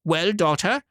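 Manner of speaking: high, expectant call; mother greeting her returning daughter; eager mother. Acted. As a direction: call out hoping for news. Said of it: "she cried out".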